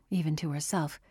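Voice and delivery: ordinary delivery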